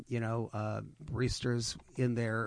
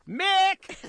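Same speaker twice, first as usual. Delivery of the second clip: calling "Mick!" across an empty shop, much higher and louder; a loud, high shout of "Mick!"; screamed name; 11 dB louder than usual. Retold or imitated. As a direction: yell the name like a barista in a crowded café. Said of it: "She screams".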